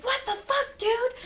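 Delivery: imitated disbelief in a high, lighter voice; mock shocked outrage. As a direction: play his shock high-pitched, without shouting. Kyle walks in on the assault.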